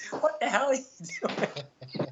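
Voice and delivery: slow, high, baffled teacher voice; incredulous teacher. Retold or imitated. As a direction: play the stunned teacher, drawn out. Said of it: "the teacher going like".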